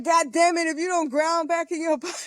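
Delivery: high, exasperated, laughing scold; scolding sister. Retold or imitated. Sister refusing to be left alone, high laughing scold. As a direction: nag her back down with a laugh. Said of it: "She's like".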